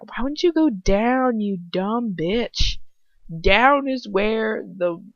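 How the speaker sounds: slow, loud disbelieving rant; exasperated scolding. Real, not acted. She scolds the heroine for running upstairs. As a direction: scold the character for going up.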